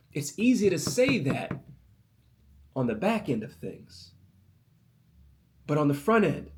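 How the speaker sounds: loud, high preaching voice; a preacher voicing the seed's regret, then pushing on. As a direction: preach it up to a peak. Preaching climbs again about the seed.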